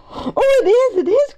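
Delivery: high, gushing agreement; excited cry. A narrator's reading. Benny agrees it is a mystery, high excited cry. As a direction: burst out in eager agreement. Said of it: "cried Benny".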